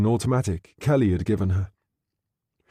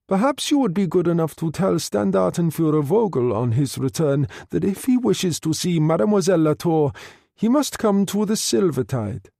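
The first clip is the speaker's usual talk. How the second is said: higher, formal officer's command; German captain Muller leaving word for Vogel, higher; voiced Muller; 6 semitones higher than usual. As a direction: deliver the order with cold politeness. Muller leaves message.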